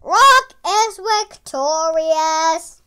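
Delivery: slow, loud arena announcer call; drawn-out victory announcement; fight announcer. Acted. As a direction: boom it out like a ring announcer. Announcer declares the winner.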